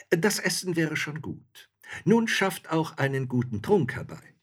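high, cheeky demand; bold soldier. A narrator's reading. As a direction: soldier confidently ordering a drink. Soldier orders drink.

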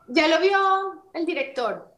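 high, slow imitation of the producer; imitated producer. Producer telling the actress the director saw it, imitated high and slow. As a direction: draw it out high, mimicking the producer. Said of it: "pero así, ¿no?".

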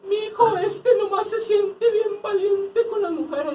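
put-on very high, slow, laughing whiny old woman; whining old lady. Man mimicking the señora scolding the bully, whiny. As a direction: whine like an offended señora.

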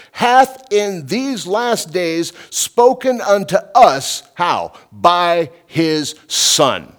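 slow, swelling preacher's reading of scripture. Acted. Preacher reading Hebrews to the congregation, slow and swelling. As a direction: read the verse with rising force.

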